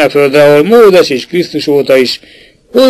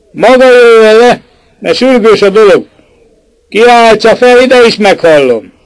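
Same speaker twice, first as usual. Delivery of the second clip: shouting up; 7 semitones higher than usual. A narrator's reading. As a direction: call up insistently. Shouting up to scaffold.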